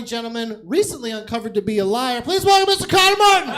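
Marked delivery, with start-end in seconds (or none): 2.2-3.5 host intro. Pretend. Host introducing next comedian.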